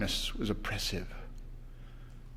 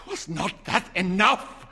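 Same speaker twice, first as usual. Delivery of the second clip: fierce; 8 semitones higher, 4 dB louder than usual. A narrator's reading. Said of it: "he demanded fiercely".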